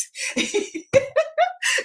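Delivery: a burst of high-pitched laughter; real high laugh. Real, not acted. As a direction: laugh out at the memory. Laugh after telling mom.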